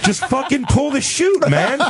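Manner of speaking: high, laughing exasperation; mock pleading, laughing; exasperated urging. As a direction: beg him to bail, cracking up. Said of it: "you're like".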